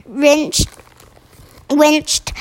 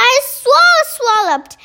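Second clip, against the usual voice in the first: high-pitched storybook bellow; giant's bellow; 10 semitones higher than usual. A narrator's reading. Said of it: "bellowed the bone cruncher".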